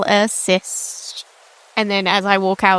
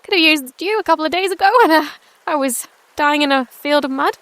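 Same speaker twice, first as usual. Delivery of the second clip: higher-pitched, joking remark; making light; 6 semitones higher, faster than usual. Acted. Newcomer joking to the healers, light and higher. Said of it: "make a bit of light of it".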